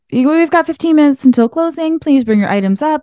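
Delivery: high-pitched, breezy shop announcer; confident store announcement. Retold or imitated. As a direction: sing out the closing announcement with ease. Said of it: "Then I'd get more comfortable".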